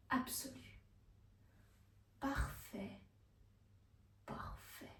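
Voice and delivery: very soft, fading to a whisper; hushed awe. A narrator's reading. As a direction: whisper it away in separate breaths. (murmure qui s'éteint en chuchotant)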